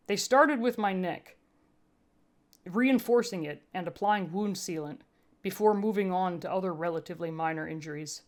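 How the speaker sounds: ordinary delivery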